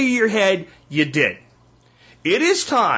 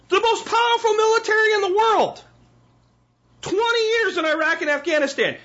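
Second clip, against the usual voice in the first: heated rant; 6 semitones higher than usual. Real, not acted. Ranting about war failures.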